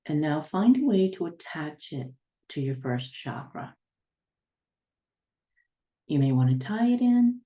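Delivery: unhurried guiding voice, long silences between instructions; guided meditation. Real, not acted. Healer guiding listeners to ground down to the planet's center, guided meditation. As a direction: lead them step by step, letting each image settle.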